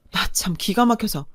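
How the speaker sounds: plain reading voice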